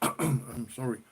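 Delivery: usual speaking voice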